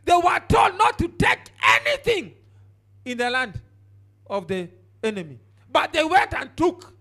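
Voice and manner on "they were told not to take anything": higher, louder, slow shout; preaching shout. Acted. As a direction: drive it home at full voice.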